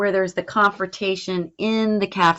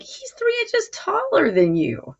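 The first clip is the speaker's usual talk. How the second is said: much higher voice for the friend Kevin; Kevin's voice; 13 semitones higher than usual. Acted. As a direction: pitch jumps up to voice the doubting friend. Reading Kevin's line of dialogue.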